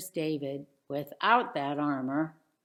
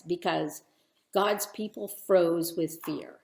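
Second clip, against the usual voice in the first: plain reading voice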